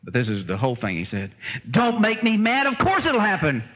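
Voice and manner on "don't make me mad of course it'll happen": high, emphatic retort; acted indignant confidence. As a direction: throw it back as absolute certainty. Acts out husband's confident reply.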